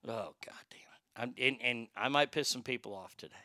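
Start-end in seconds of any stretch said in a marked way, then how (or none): 0.1-1.0 under-the-breath whisper; whispered curse. Real, not acted. Muttered curse at screen.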